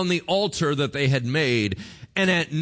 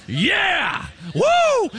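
excited cheer; 6 dB louder than usual. Real, not acted. Pastor cheering his own name.

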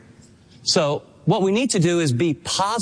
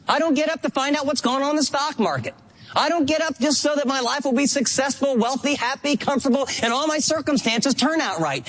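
higher, urgent preaching as Paul; passionate Paul; 5 semitones higher than usual. Pretend. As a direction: declare it with fire as Paul. Voicing Paul, passionate sermon.

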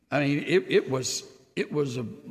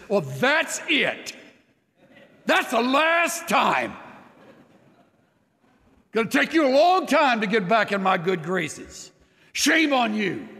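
mock scolding; 7 semitones higher than usual. Voicing an angry God he rejects.

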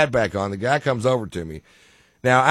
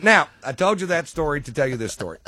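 ordinary talk

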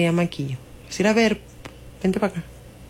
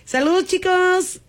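high put-on voice; 11 semitones higher than usual. Greets car washers in funny voice.

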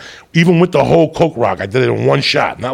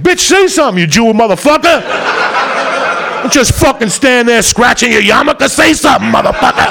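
mock yelling at attorney; 10 semitones higher than usual. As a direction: bark at the lawyer to speak up, half laughing. Yelling at his own attorney in court.

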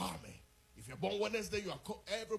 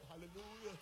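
ordinary talk

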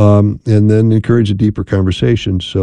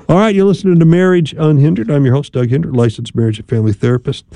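radio host sign-off; 3 semitones higher than usual. Acted. Radio therapist to listeners before the break, upbeat announcer. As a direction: sell the station break like a host.

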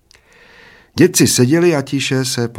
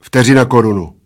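loud, prompt reply; fired off; 2 semitones higher, 7 dB louder than usual. A narrator's reading. Bald man naming the waiting bet, fired off. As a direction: fire the stake straight back. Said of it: "vypálil plešatec".

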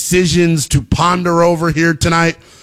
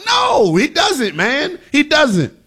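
high, drawn-out emphatic no; heated, insistent refusal; exasperated denial; 10 semitones higher, slower than usual. Real, not acted. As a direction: answer your own question in high-pitched exasperation. Emphatic answer to his own question.

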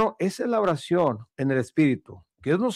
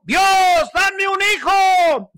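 high, loud mock yell, slow; mock shout; 6 dB louder, slower than usual. Preacher mocking a prayer he says Hannah never made, mock shouting. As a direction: shout it like a desperate woman crying to God. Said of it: "donde ella estaba gritando".

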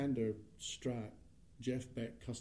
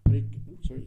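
ordinary talk, nothing marked

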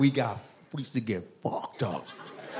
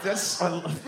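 normal talking voice

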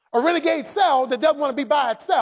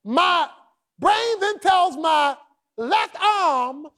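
fiery preaching; 7 semitones higher than usual. Acted. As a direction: preach it out at full force, climbing. Preacher builds to loud climax.

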